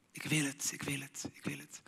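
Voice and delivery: low whispered craving, fading away; greedy whisper. Acted. Preacher voicing greed as a hungry whisper.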